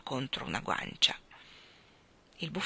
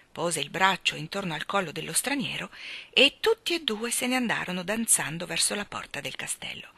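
usual speaking voice

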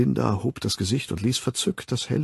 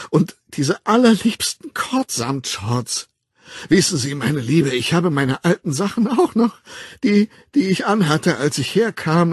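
lightly voiced chatty Linda, lively and higher in places; aufgedrehte, klagende Linda; 5 semitones higher, 4 dB louder than usual. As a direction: gush and fret like a needy woman. (aufgedreht plappernde Figur)

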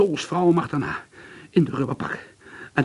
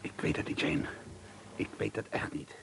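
whisper; 4 semitones lower, 13 dB quieter than usual. Acted. Nervous whispered denial.

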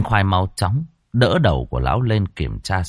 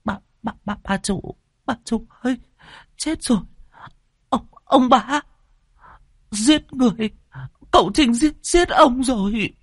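very high, stammering, crying babble; stammering in terror. A narrator's reading. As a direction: sob it out, out of your mind with fear. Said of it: "vừa chạy vừa ú ớ". (ú ớ hoảng loạn, giọng rất cao, nghẹn khóc)

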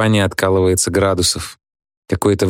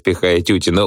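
ordinary delivery

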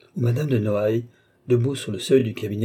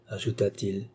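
ordinary delivery, nothing marked

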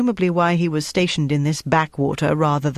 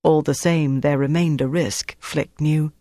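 usual speaking voice

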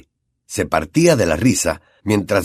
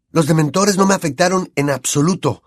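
excited; 6 semitones higher than usual. A narrator's reading. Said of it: "dijo Harry emocionado".

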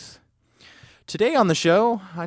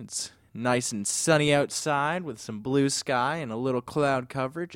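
ordinary delivery

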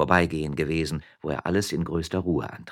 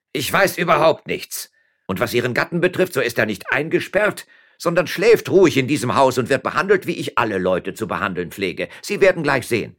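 loud, higher, blustering mayor; mayor defending himself; 7 semitones higher, 6 dB louder than usual. Acted. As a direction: protest innocence with official bluster.

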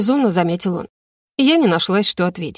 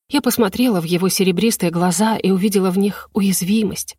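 usual speaking voice

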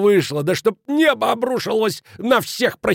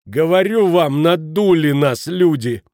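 raised, higher voice to the group; louder; 5 semitones higher, 6 dB louder, slower than usual. A narrator's reading. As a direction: insist loudly, stretching the words. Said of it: "сказал он громче". (громко, повышенным голосом)

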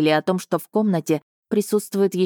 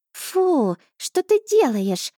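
much higher, slow squeal of disgust; indignant cat; 10 semitones higher, much slower than usual. A narrator's reading. Cat Asha protests being licked, much higher indignant squeal. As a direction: squeal in mock outrage. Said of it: "возмутилась она".

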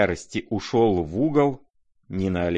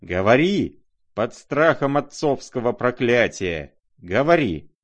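loud, commanding cry; frenzied roar; 5 semitones higher, 5 dB louder than usual. A narrator's reading. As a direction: roar the order in a fury. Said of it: "ревел генерал в совершенном исступлении".

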